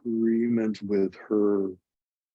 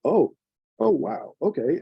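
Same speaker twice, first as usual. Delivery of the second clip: surprised; 6 semitones higher, 4 dB louder than usual. Retold or imitated. Retold surprise at the opportunity.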